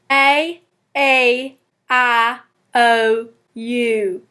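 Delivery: one drawled Southern vowel at a time. Shown as a demonstration. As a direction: model each Southern vowel for repeating. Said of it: "practice all these vowel sounds".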